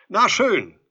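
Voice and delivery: very loud, high giving-up; exasperated calling. A narrator's reading. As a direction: throw it out loudly, fed up. Visitor gives up, annoyed.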